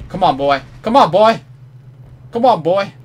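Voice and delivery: high, loud egging on; loud urging. Real, not acted. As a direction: shout it like cheering a racehorse. Cheering at the screen.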